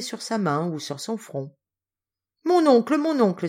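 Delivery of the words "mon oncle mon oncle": high, loud, happy call; joyful cry. A narrator's reading. Niece to her uncle in joy, excited cry. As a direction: cry out with overflowing gratitude. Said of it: "s'écria-t-elle".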